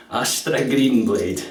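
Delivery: slow, Astra Green blade with a rolled Scottish R; Scottish rolled R's. Shown as a demonstration. Naming the blade for viewers in a slow rolled-R Scottish accent. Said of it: "roll your R's".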